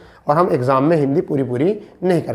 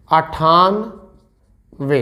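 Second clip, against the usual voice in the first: each syllable slowly drawn out; slow dictation; much slower than usual. Shown as a demonstration. Teacher dictating numbers to students, slow and drawn out. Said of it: "इसे कहते हैं".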